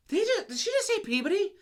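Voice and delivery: high incredulous question; retold disbelief. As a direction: ask it as if you can't believe your ears. Retelling her shocked reaction to the news.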